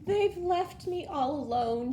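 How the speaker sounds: lonely spider crying, high and loud. A narrator's reading. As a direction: cry it out in despair. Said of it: "she cried".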